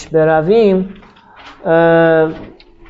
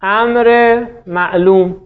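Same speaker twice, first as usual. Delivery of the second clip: slow dictation; much slower than usual. Shown as a demonstration.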